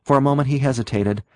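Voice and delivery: plain reading voice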